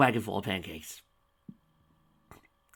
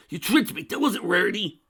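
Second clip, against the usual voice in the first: furious shout; 11 semitones higher, 9 dB louder than usual. Acted. Spike furious.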